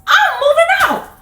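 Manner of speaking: very high, loud crying declaration; high loud tearful kid; crying child outburst. Retold or imitated. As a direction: wail it like a furious kid. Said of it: "I was like".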